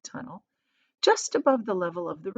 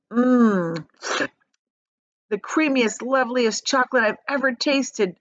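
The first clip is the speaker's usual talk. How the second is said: put-on delighted old man's murmur, higher; Grandpa Joe savouring; 4 semitones higher, 4 dB louder than usual. A narrator's reading. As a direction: savour every word like chocolate. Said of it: "said Grandpa Joe, smacking his lips".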